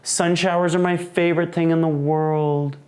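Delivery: high, thrilled and joyful; excited delight. Real, not acted. Seeing a sun shower out the window, thrilled and high. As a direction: burst out with joy at the sight.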